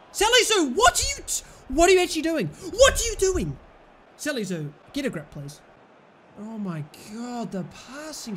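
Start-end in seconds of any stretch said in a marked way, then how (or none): 0.1-3.6 higher and louder, demanding what he's doing; exasperated yell. Real, not acted. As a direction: shout at the player in disbelief. Yelling at defender.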